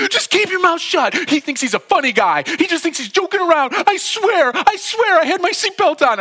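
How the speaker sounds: very high, hysterical shouting; coming unraveled. Retold or imitated. Driver raging at friend, swearing innocence to officer, unraveled. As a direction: imitate a man losing it, loud and shrieky throughout. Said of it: "Mark is coming unraveled".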